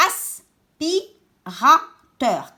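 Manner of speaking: "Aspirateur" very slowly as as, pi, ra, teur; syllable by syllable. Shown as a demonstration. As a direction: chop "Aspirateur" into four separate syllables. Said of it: "Quatre syllabes". (« Aspirateur » découpé lentement en quatre syllabes)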